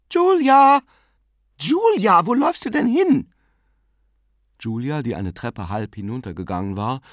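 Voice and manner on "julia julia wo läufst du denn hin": very high, loud call. A narrator's reading. Aunt calls after her sister on the stairs, calling out.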